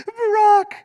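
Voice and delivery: high, hammed-up sob calling his name; mock sobbing Barack. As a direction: wail his name like a heartbroken fan. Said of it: "I'm like".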